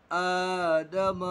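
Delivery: आदम with the first आ held long; slowly drawn out. Shown as a demonstration. Teacher drawing out आदम for the class. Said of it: "खींचकर पढ़ेंगे".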